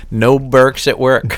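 a slow mock podcast title; slow title read. Shown as a demonstration. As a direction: say the title slowly. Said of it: "can we change the name".

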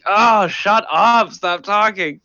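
high, drawn-out fed-up groan of words; mock exasperation. Locals sick of the newcomer's accent, hammed-up exasperation. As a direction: imitate someone who can't bear listening. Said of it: "would just piss everybody off".

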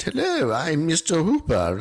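mimicking a friend's British-sounding character voice; slightly British accent. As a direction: imitate a slightly British impression. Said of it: "that's how you just did that".

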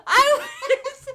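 loud laughing complaint, losing it; helpless laughter through the words; laughing hard. Real, not acted. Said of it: "I was on a roll".

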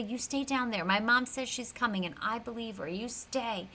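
put-on softly, firmly telling the kids to stay; hushed warning. Girl quietly ordering kids to stay hidden, hushed. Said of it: "she tells everybody".

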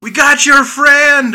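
loud high yell. Retold or imitated. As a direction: shout it out in alarm.

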